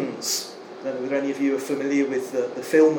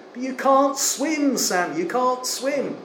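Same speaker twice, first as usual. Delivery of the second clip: alarmed plea; 9 semitones higher, slower than usual. Retold or imitated. Frodo alarmed at drowning Sam.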